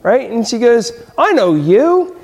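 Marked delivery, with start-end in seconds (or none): 1.2-2.1 Maisie recognizing him. Acted. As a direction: call out in surprise as the lazy bird. Maisie spots Horton in circus.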